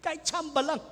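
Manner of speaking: a begging voice, high and thin; high pleading prayer. Retold or imitated. Widower begging God for a dream, high pleading. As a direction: raise the pitch and plead with the Lord. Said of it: "Lagi ko sinasabi".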